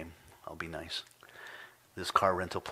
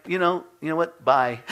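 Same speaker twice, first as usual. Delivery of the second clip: dismissive goodbye; 7 semitones higher, 5 dB louder than usual. Retold or imitated. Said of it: "I'm like".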